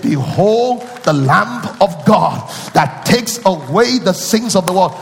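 slow ringing pulpit proclamation; proclaimed verse. Shown as a demonstration. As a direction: announce it like the Baptist crying out. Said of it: "He said".

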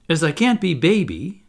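mildly mounting disappointment; getting heavier. A narrator's reading. Toodles refused as baby by Michael, getting heavier. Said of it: "getting heavier and heavier and heavier".

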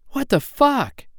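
jolted awake, blurt it out; startled shout. Acted. Woman jolted awake by a gunshot, startled shout.

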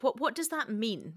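ordinary talk

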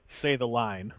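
low, quiet, deadpan command; acted stern director, dropped in pitch and volume; terse director's order. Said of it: "he's like".

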